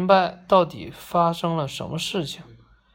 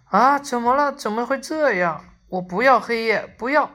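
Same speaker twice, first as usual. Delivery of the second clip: higher-pitched, acted cry of alarm; panicked outcry; 4 semitones higher than usual. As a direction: cry out as if helplessly panicking in the dark. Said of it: "忍不住大喊大叫". (惊慌地喊叫)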